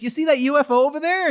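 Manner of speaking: put-on high, loud and fast kid; imitating an eager schoolkid, high-pitched; excited kid. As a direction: pipe up like an excited child. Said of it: "was one kid like".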